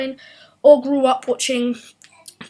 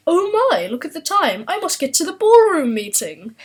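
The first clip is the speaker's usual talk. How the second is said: hammed-up upper-class English, a bit higher; mock posh British accent; 4 semitones higher, 4 dB louder than usual. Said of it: "stereotypical British accent, like".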